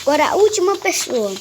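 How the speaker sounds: excited rush to the last customer; hurried call. Real, not acted. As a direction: rattle it off, on to the next one.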